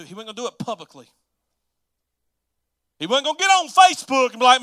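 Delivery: normal talking voice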